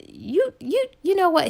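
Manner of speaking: high-pitched, stammering retold anger; mock angry sputter. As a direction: sputter as if getting worked up. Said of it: "He just kept getting angry".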